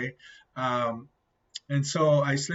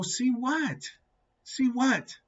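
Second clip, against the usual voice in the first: very high, incredulous retort; squeaky retold bewilderment; baffled question; 12 semitones higher, slower than usual. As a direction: act out being totally lost. Retold baffled reply to his friend.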